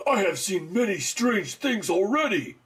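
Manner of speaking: stiff, earnest movie-star read; Keanu impression. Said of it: "Keanu was, like, really outclassed".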